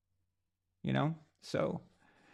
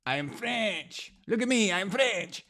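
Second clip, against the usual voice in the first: mock French accent; 6 semitones higher than usual. Showing off as a Frenchman to the stream, hammed-up French accent. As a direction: proudly announce you're French like a cartoon. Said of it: "another French impression".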